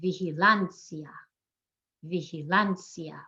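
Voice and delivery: slow "vigilancia", stressed vi-hi-LAN-sia; second-to-last stress. Shown as a demonstration. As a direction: lean on LAN in "vigilancia", "ia" as one sound. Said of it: "emphasize the second to last syllable".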